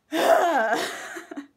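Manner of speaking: high burst of laughter; loud laugh. Real, not acted. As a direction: let out a big laugh. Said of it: "I need to calm down".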